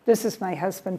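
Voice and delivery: usual speaking voice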